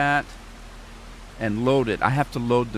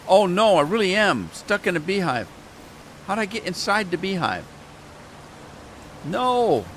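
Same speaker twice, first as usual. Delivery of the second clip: dismayed surprise; 7 semitones higher than usual. Real, not acted. Player stuck in a beehive, surprised and dismayed. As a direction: cry out in disbelief.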